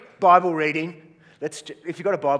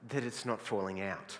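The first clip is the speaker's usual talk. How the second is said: deadpan punchline; 3 semitones lower, 6 dB quieter than usual. Acted. Calm punchline to exasperated older guy.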